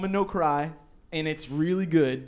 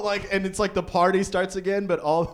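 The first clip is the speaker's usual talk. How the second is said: normal talking voice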